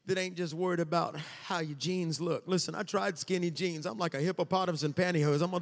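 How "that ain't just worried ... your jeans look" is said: ordinary talk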